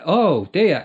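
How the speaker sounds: usual speaking voice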